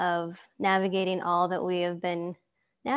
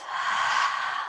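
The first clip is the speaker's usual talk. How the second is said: long breathy exhale through the mouth; audible exhale. Shown as a demonstration. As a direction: let the breath out slowly and audibly. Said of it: "Let's just do that a few times".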